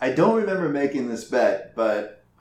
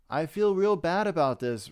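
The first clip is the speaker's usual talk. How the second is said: fake remorse; 5 semitones higher than usual. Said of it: "He lied".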